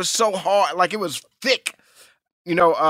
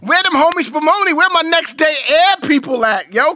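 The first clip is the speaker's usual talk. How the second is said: fast hype shout; high, fast shout; 7 semitones higher, 3 dB louder than usual. Pretend. Pumping up his fans with a fast loud shout.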